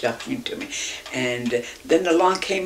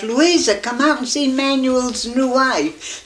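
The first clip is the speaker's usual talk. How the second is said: hollering; 6 semitones higher, slower than usual. Retold or imitated. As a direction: holler for the wife to come out. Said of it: "he hollers to his wife".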